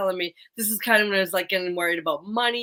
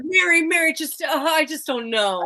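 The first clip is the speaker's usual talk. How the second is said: mock confused whine; 8 semitones higher, 4 dB louder than usual. Teasing a friend by mimicking her confusion. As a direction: play the lost, confused one.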